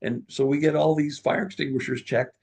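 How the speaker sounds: animated boss. Retold or imitated. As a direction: pile on the new duties. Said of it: "And I said".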